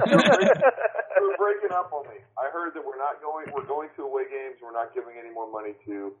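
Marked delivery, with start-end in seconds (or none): 0.0-2.2 laughing. Real, not acted. Laughing while teasing caller.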